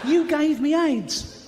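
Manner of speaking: high, slow, outraged accusation; accusing shout. Acted. Man confronting the chimp in the jungle, high and furious. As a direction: wail it at the chimp, betrayed.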